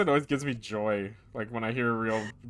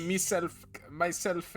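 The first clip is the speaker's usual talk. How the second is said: put-on Jamaican accent; 4 semitones higher, 4 dB quieter, much slower than usual. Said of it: "why is it Jamaican?".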